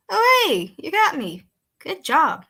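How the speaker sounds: high, loud sing-song greeting; chirpy and unsettling; creepy cheerful Peter. Acted. Peter voiced in story.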